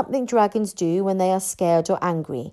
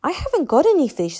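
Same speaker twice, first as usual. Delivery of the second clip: Dragonese; 7 semitones higher, 4 dB louder, faster than usual. A narrator's reading. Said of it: "said Hiccup in Dragonese".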